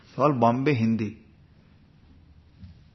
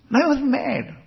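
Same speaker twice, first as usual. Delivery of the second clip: much higher, hammed-up protest; mock exasperated insistence; 12 semitones higher than usual. Devotee still not understood by Swamiji, mock exasperated. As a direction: imitate someone at the end of their patience. Acted complainer insists once more.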